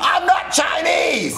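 high, drawn-out shouted protest; stretched, high cry of protest; exasperated denial. Acted. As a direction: wail it slowly in frustration.

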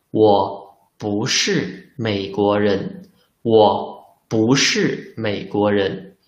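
slow, careful Mandarin answer; slow model answer. Shown as a demonstration. Teacher models the answer slowly for learners, careful Mandarin. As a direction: spell out each syllable slowly. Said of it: "can say".

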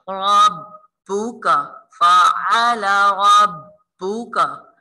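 slow drawn-out chanted verse; tajweed recitation. Shown as a demonstration. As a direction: recite slowly with clear ain. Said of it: "आइन की आवाज़ निकालिए".

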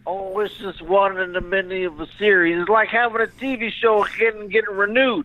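high, put-on celebrity voice; Bill Cosby. Comic as Bill answers host, high-pitched. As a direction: ramble in a high comic impression. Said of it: "here, Bill, take the phone".

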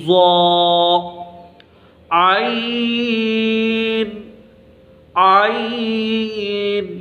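عین held very long from the throat; slow throaty عین; throaty ain. Shown as a demonstration. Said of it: "یہ بھی حلق سے نکلے گی آواز".

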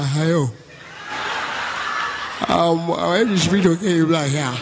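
very calm presidential impression; calm mock-president on the phone; President Muhammadu Buhari. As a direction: imitate an unhurried, slurring statesman. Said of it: "our president voice, very calm guy".

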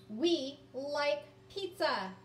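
slow, each word spaced; slow choral read. Shown as a demonstration. Teacher reads along with children, slow and even. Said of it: "Can you say that sentence with me now?".

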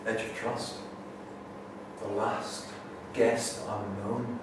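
soft, slow verse with long pauses; hushed, halting poetry reading; poem recital. Acted. Reads own poem aloud.

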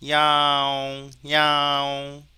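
loud, long-held meow on a level pitch; cat meow imitation. Shown as a demonstration. Teacher recording cat sounds for training, imitated meowing. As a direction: stretch out each meow like a cat. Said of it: "고양이 사운드를 한번 우리가 저장해 보면".

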